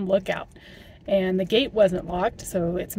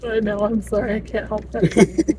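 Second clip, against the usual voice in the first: nervous; 6 dB louder than usual. Real, not acted. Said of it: "you were getting so freaked out".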